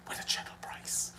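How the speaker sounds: ad slogan whispered; whispered ad line. Retold or imitated. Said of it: "he said".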